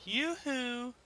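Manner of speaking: high teasing singsong yoo-hoo; taunting call. Real, not acted. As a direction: call out to mock the opponent. Taunting an enemy in game.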